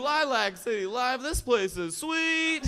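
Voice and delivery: loud, high crowd call; rally the room; comic signing off to the audience. Real, not acted.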